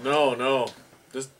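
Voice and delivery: usual speaking voice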